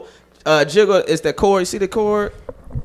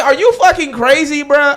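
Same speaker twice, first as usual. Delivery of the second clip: higher, indignant half-yell; exasperated; 9 semitones higher, 4 dB louder than usual. Retold or imitated. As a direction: scold in disbelief. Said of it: "I said".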